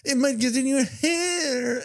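high, slow mocking whine; hammed-up high worrywart voice. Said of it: "he's like".

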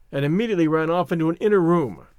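plain reading voice

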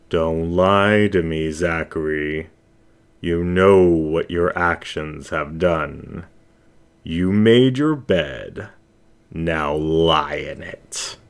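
menacing shadow voice. Acted. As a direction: savour each word like a hunter cornering prey. Shadow voice passes judgement.

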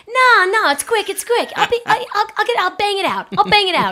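high, loud eager dismissal; excited brush-off. Retold or imitated. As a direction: talk it down fast and loud. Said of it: "Even if people tell you".

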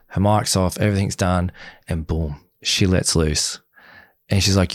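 ordinary talk, nothing marked

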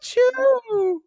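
very high, slow squeaky puppet voice; a creepy clown puppet teasing slowly; squeaky ventriloquist dummy. Acted. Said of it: "this voice when I'm laughing".

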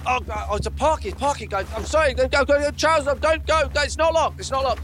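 high, loud flustered panic; panicked flustered. Acted. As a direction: scramble to cover up, caught out. Caught by visitors at the door.

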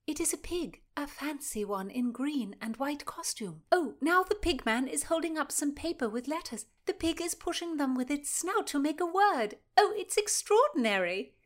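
relaying the show to a friend, growing excited. Acted. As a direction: marvel at the clever pig. Constance relays the pig show.

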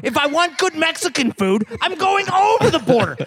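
worked up and loud; excited rant. Real, not acted. Ranting about Mexican food.

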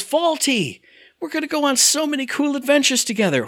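high-pitched eager character imitation; Timmy. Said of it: "he goes".

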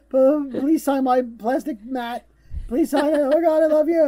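high, loud, gushing fangirl parody; mock hysterical fan. Teasing co-host about storming a celebrity's booth, mock hysterical fan. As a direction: beg for an autograph, hammed-up and high-pitched.

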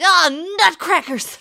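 loud, high cartoon-kid outburst; Butters. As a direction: squeal a harmless curse like a cartoon boy. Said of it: "I go full on South Park, like Butters".

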